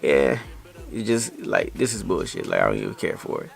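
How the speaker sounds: low, quiet, unimpressed aside; dismissive. Retold or imitated. Listener brushing off somebody's bullshit, low and dismissive. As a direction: drop low and soft, not caring.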